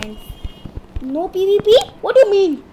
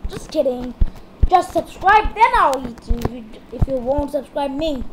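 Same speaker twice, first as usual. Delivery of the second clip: ordinary talk, nothing marked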